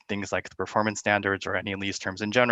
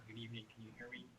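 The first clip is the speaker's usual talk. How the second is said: very faint, distant voice; barely audible; 28 dB quieter, much faster than usual. Real, not acted. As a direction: speak as if far from the mic. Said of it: "Tim, your voice is very faint".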